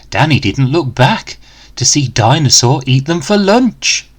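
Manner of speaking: ordinary delivery, nothing marked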